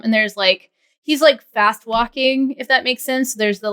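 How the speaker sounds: ordinary talk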